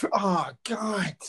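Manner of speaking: high-pitched imitation of a miserable mum; mock exasperation. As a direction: groan it as mum who can't bear the noise. Mum covering ears at children's laughter.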